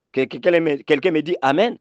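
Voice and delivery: ordinary delivery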